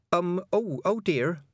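put-on high, hesitant girl's voice; young witch. A narrator's reading. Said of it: "She stayed bowed and said".

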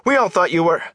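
high, urgent call cut short; shouted. A narrator's reading. Young man calling to the peddler he believed lost, shouted. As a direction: call after him, breaking off. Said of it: "he shouted".

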